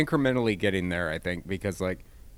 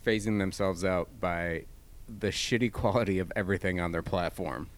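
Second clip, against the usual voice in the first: ordinary talk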